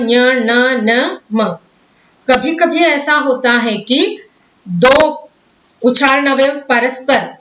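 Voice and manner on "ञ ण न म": ञ, ण, न spelled out very slowly, one by one; slow letter drill. Shown as a demonstration. Teacher listing the nasal letters slowly for students. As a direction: draw out each nasal letter for the class. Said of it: "नासिका से उच्चरित है ङ".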